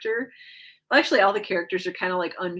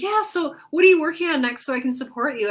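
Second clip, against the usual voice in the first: eager friend; 7 semitones higher, 5 dB louder, faster than usual. Retold or imitated. Friends asking about next project.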